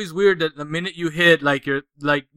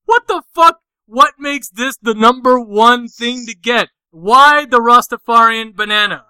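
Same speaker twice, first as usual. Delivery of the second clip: baffled outrage; 5 semitones higher, 4 dB louder than usual. Retold or imitated. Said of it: "I was like".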